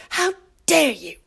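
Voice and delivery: a quick second mock of the activist; mocking Greta Thunberg. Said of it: "To quote that Greta Thunberg".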